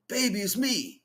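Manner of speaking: high, loud put-on Chinese accent; pleading "baby it's me" in a hammed-up Chinese accent; mock Chinese accent. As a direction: ham up a Chinese accent as the dead man back in a new body. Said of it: "accent of the Chinese".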